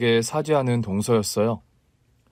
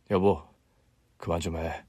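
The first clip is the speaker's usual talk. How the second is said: low, much softer husband's plea; husband pleading softly with his wife; quiet plea; 7 semitones lower, 7 dB quieter than usual. Acted. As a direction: hush her gently, almost under breath.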